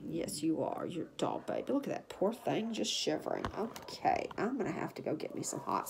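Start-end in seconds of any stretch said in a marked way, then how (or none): none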